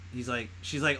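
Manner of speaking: normal talking voice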